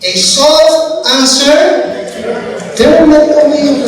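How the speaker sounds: slow proverb. Shown as a demonstration. Proverb repeated slowly.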